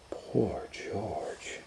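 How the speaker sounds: hushed, breathless pity; pitying whisper. Acted. Dad whispering to Leo about poor George, pitying whisper. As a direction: whisper it like a sad secret.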